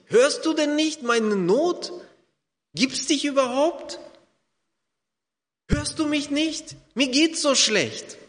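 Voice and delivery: high, pleading cry to God, slowed; pleading lament. Acted. Preacher voicing a despairing believer, pleading lament. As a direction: beg God to answer, half-acted.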